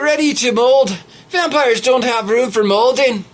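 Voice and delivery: badly faked Irish lilt for reading aloud; attempted Irish accent. Reader showing off an Irish voice on the story, shaky Irish accent. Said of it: "You should hear my Irish voice".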